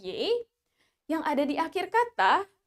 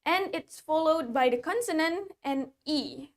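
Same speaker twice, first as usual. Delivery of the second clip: ordinary talk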